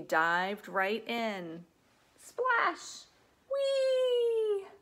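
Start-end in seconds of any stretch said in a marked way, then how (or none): 2.2-4.7 very high, loud playful cry; gleeful whee. Acted. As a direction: squeal it like a kid on a slide. Sound effect and joyful cry.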